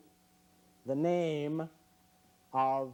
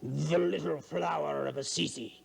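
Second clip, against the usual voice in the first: sinister inflection. Acted. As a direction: make the saint sound like a bad guy. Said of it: "simply by voice inflection".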